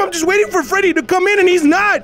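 high, anxious pleading; nervous panic. Real, not acted. Player waiting for Freddy at four A M, nervous and high. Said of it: "I'm nervous and it's four A M".

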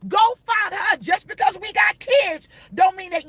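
preach-shout, hammering each word. Acted. Preacher voicing the left partner to the cheater, shouting.

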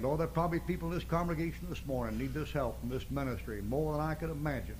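soft, hushed prayer to the Lord; pastor's prayer. Acted. Pastor praying softly to close the sermon. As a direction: pray quietly over the congregation.

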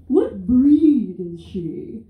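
slow, loud, drawn-out question; pushy mom. Retold or imitated. A Florida mom quizzing her about the dog, slow and loud. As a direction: lean in like a nosy Florida mom. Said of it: "And the mom goes".

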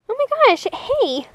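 ordinary talk, nothing marked

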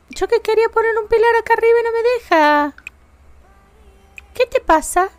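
high, plaintive whine; whiny complaint. Real, not acted. Complains the game blocks her. (queja aguda y lastimera)

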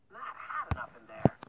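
loud, high indignant huff; huffy. A narrator's reading. Baker woman huffs at Hansel in the oven. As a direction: huff it back in annoyance. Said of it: "the baker woman huffed".

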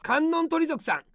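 usual speaking voice

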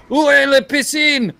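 high, loud mock French; blurting out schoolbook French; French phrase. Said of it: "started saying all the random words I know".

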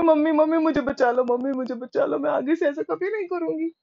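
put-on high, frantic child begging to be saved; a child's high, desperate plea; pleading child. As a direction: beg mom like a terrified little girl. Child begging mother for help.

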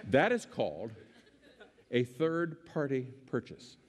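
long dramatic pause before "a third-party purchase"; pause for effect. Shown as a demonstration. Speaker pausing to let the audience hear "third-party purchase", deliberate pause. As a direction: hold the silence, then land "third-party purchase" slowly. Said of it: "remember I said I was gonna pause for effect".

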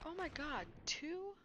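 real shock at spotting two at once; startled gasp. Real, not acted. Gamer spotting two monsters, startled outcry.

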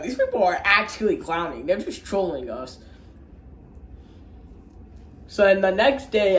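plain reading voice

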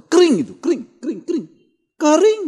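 high, loud yelps with falling tune, last one held up; mock it in high bursts; high mocking repetition. Shown as a demonstration. Preacher mocking dry sermons, high yelped repetition.

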